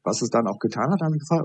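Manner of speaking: ordinary talk, nothing marked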